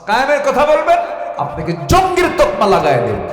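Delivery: very loud, high preaching cry; indignant shout. Real, not acted. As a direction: burst out in loud protest. Indignant warning to the congregation. (চড়া গলায় ক্ষুব্ধ চিৎকার)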